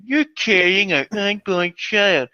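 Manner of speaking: ordinary talk, nothing marked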